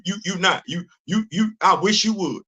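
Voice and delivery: ordinary delivery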